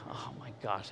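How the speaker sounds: whispered, low disbelief; muted dismay. Retold or imitated. Patient hears he must wait, whispered retold dismay. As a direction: groan it softly under your breath.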